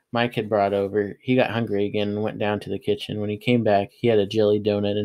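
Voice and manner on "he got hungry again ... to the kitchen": plain reading voice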